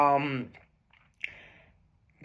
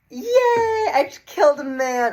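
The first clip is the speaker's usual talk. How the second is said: squeaky high, louder fake delight; mock cheery; 11 semitones higher, 6 dB louder, slower than usual. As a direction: chirp it like a proud killer. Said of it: "She was like".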